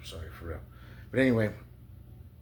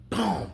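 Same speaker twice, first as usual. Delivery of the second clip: mock crash; 11 semitones higher, 5 dB louder than usual. Rock smashing the window.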